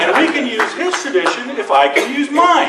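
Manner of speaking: loud, high-pitched comic ultimatum; mock threat. Lawyer answering Spain's tradition with Chicago's, loud mock threat. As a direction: belt out the threat as a punchline. Acted threat, punchline of story.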